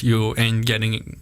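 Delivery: usual speaking voice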